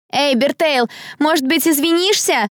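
high, sneering taunt; mocking shout. A narrator's reading. Long-haired girl to accused rival, mocking shout. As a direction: call out to humiliate. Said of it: "самым издевательским тоном".

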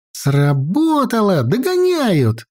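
put-on high girl's voice, mildly military; crisp military report. A narrator's reading. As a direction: report the success like a young soldier. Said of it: "по-военному чётко доложила Наташка".

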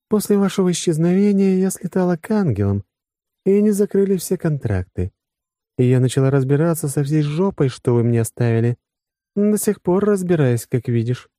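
high-pitched female character, mildly aggrieved; put-upon woman. Acted. Woman recounting the mess left to her, high aggrieved voice.

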